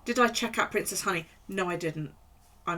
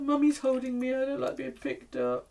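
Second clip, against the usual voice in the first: a touch higher cutesy dog voice; voicing the dog; 6 semitones higher than usual. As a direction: grumble for the dog being held. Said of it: "She's like".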